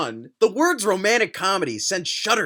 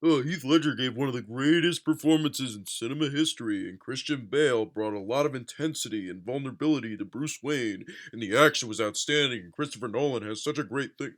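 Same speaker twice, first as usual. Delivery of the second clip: lightly put-on reviewer voice, lower; mocking the author; 5 semitones lower, 4 dB quieter than usual. Guessing the author's Dark Knight paragraph, mock reciting. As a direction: recite it like predictable praise. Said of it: "I know what he said".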